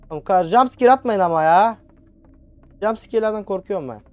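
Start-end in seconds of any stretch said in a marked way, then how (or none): none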